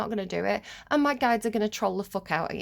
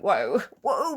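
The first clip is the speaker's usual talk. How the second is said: amazed; 3 dB louder than usual. Retold or imitated. Reacting to being right.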